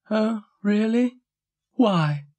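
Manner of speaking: high, drawn-out dog character voice; Dougal feigning innocence. A narrator's reading. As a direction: pitch it high and questioning, stretching the words. Dougal feigns surprise at Florence's worry.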